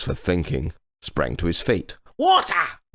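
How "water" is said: goblin shout. A narrator's reading. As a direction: blurt it out like a proud little goblin. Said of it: "he shouted".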